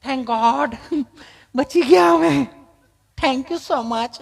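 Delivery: high, prissy mock relief; relieved city visitor. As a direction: thank heaven like a shaken, affected tourist. Visitor relieved the dog has gone.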